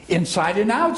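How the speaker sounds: high-pitched, mildly proud; proud Pooh. A narrator's reading. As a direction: show it off with a touch of pride. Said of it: "said Pooh proudly".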